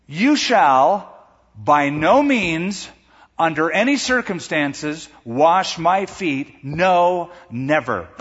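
slow, weighted "You shall by no means"; emphatic refusal. Acted. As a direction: pause between phrases and punch "no" and "wash my feet". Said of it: "It's a strong double negative".

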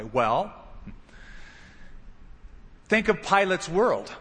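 usual speaking voice

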